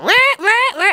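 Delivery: high-pitched blaring alert noise; imitated phone alarms. Imagining thousands of phones at school, high alarm imitation. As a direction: mimic alarms going off, high and repeated. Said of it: "all going".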